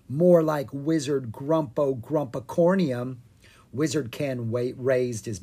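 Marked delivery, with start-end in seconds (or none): none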